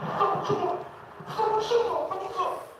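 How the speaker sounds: high-pitched, hyped-up greeting. Retold or imitated. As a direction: call out a buddy way up high. Acted greeting, voice way up.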